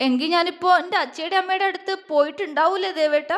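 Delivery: wife asking through tears what might have been; tearful. A narrator's reading. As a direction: wonder aloud through tears. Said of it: "കണ്ണു നിറച്ചുകൊണ്ട് പുഞ്ചിരിച്ചു".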